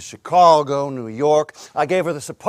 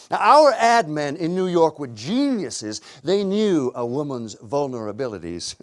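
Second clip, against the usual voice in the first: ordinary talk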